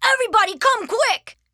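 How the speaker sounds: high, loud shout to the family; child hollering for the household; excited call. Acted. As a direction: summon everyone urgently. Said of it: "called Arthur".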